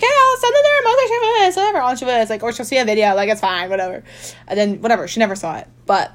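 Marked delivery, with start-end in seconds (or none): 0.0-2.3 high, offhand, dismissive mimicry of herself; mock casual self-quote. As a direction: toss it off lightly, as her careless younger self. Mocking her own past casualness.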